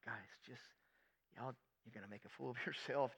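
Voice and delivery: hushed aside to the disciples; imitated quiet warning; mock whisper. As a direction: whisper it as a private word. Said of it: "not whispering like".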